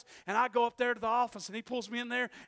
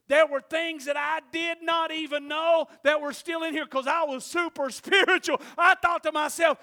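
higher, louder preaching; 4 semitones higher, 11 dB louder than usual. Acted. Preacher building to the congregation, louder. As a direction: proclaim it at full force.

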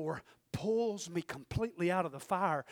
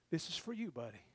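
a hint of a calm, softer God; God's reply; 4 semitones lower, 9 dB quieter than usual. God telling the pot this is for him, hushed and low. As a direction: drop lower and softer, matter-of-fact.

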